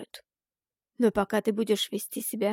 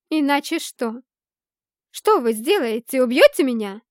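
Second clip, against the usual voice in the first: louder, higher angry questions; angry challenge; 3 semitones higher, 9 dB louder than usual. A narrator's reading. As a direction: throw the dare at her. Heroine challenges Mara angrily. (гневный вызов)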